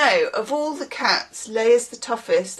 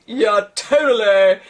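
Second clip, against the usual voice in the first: slow, loud dude impression; Bill and Ted style; 5 dB louder than usual. Reader riffing on a definition, in a Bill and Ted voice. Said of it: "whole kind of Bill and Ted".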